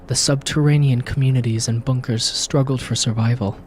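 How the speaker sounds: low, grave trailer narrator voice; dramatic narration. A narrator's reading. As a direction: read it like an epic movie intro. Reads game intro as narrator.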